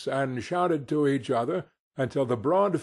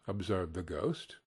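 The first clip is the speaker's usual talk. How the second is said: ordinary delivery, nothing marked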